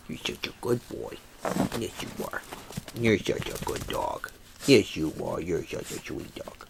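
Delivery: soft breathless whisper of praise; whispered dog praise. Real, not acted. Praising his dog Nugget in a soft whisper. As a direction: whisper sweet nothings to the dog.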